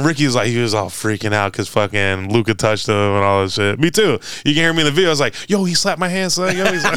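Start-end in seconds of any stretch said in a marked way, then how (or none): 5.5-7.0 put-on kid-like excitement. Little kid moment, excited.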